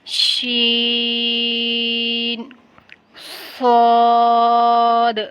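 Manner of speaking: شین and صاد each held long on a level note; slow tajweed chant of شین، صاد; drawn-out letter names. Shown as a demonstration. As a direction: sustain the vowels of شین and صاد. Models each letter for learners.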